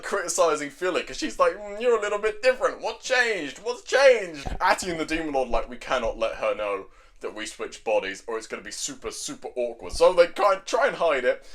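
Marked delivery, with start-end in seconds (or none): 1.6-4.5 high, nosy mock questioning; prying. Witch quizzes Philly about changes, prying. 5.7-9.9 low, hushed aside; lowered voice, mock conspiratorial; secretive. Atty and Demon Lord hide body swap, secretive.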